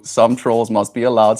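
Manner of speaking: ordinary talk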